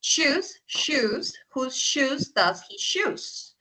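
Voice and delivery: slow, deliberate tongue twister read; slow careful demo. Shown as a demonstration. Teacher slowly models the twister for her students. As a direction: spell it out slowly for the class. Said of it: "teacher repeats it slowly".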